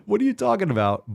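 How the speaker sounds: high, incredulous disbelief; can't believe what you just read. Real, not acted. Reacting to a diet post.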